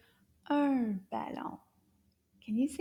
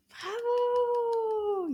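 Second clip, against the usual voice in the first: cheering praise; 11 semitones higher, 3 dB louder than usual. Real, not acted. Praising the pupil.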